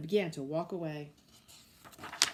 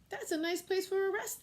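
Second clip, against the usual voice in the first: light peddler voice; 11 semitones higher than usual. A narrator's reading. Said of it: "thought he".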